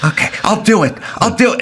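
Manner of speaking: mock submissive sidekick; meek giving in. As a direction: give in like a scared henchman. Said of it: "acted reply".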